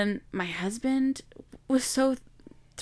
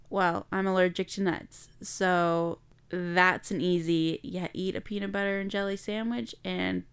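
ordinary talk, nothing marked

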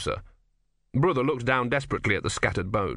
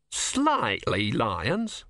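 very slow, disbelieving question; stretched-out incredulous repeat; incredulous echo; much slower than usual. Acted. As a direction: draw out SLIGHTLY, rising in disbelief at the end.

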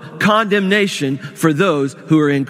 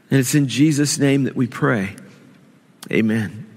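prayer; 2 semitones lower than usual. Acted. Pastor prays before the sermon.

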